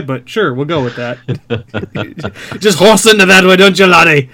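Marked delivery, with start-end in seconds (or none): 2.6-4.3 loud, hammed-up Scottish accent; awkward Scottish accent. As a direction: sell it like a pushy Scottish salesman. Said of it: "a very awkward Scottish guy".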